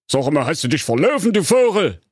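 high, fast, sneering sorcerer voice; contemptuous wizard. Acted. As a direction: look down on him with scorn. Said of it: "sah ihn verächtlich an und fragte". (verächtlicher Zauberer)

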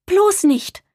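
high-pitched, horrified protest; horrified cry. A narrator's reading. Maya protests Papa's plan to tear down the treehouse, horrified. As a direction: cry out in dismay, pitch jumping up. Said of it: "rief Maya entsetzt".

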